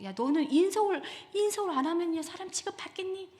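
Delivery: put-on high-pitched, mounting scolding of a child; pushy parent.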